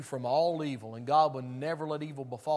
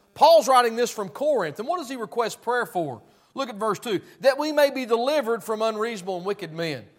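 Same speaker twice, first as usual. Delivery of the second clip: loud, high-pitched pulpit preaching; fiery preaching; 6 semitones higher, 11 dB louder than usual. Acted. Preacher to his congregation, fiery full-force preaching.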